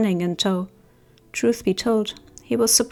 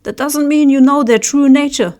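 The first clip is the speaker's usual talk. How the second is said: much louder, higher, defiant yell; yelled challenge; 5 semitones higher, 8 dB louder than usual. Acted. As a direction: shout him down. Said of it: "then someone yelled".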